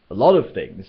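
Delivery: disbelieving repeat; surprised. A narrator's reading. Ford startled by Zaphod's vague answer, surprised. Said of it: "said Ford in surprise".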